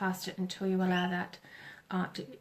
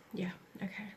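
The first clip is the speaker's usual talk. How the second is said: whispering; 9 dB quieter than usual. Real, not acted. Aside while listening to spirit.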